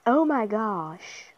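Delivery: ordinary talk, nothing marked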